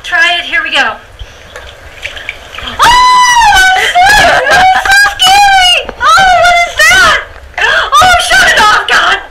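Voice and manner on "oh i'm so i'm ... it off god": shrill high terrified screaming in the dark whale; panicked shriek. Acted. Jonah shrieking in terror at what the light shows. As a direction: scream as if a monster just appeared.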